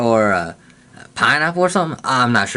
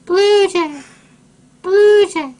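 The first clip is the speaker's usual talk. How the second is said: much higher, loud sung-out birdcall; blue jay call imitation; 4 dB louder, much slower than usual. Kid imitating a blue jay for viewers, high birdcall. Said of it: "The females even sound like this one".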